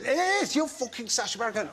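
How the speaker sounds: high, quick imitated pub bloke; mock-excited celebrity spotter; excited insistence. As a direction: insist in a high-pitched yelp.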